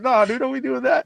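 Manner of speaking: a dad ribbing his son for acting important; mock scolding. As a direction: tease him like a stern buddy. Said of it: "you know, like".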